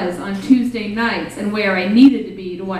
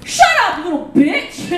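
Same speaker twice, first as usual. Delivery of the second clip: angry retort; 8 semitones higher than usual. Retold or imitated. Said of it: "his response was".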